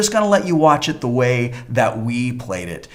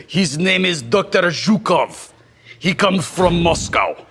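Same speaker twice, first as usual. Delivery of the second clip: put-on Russian accent. Russian scientist character from Moscow.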